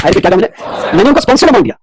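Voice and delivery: ordinary talk, nothing marked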